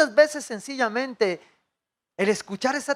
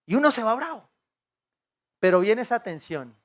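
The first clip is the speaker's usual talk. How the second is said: ordinary talk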